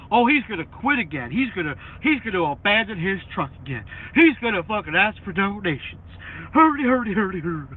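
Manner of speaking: loud sneering hater voice; mocking the haters. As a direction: mimic the haters, ending in a jeering hurdy hurdy. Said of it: "and everybody".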